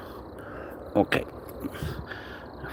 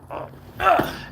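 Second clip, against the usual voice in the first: usual speaking voice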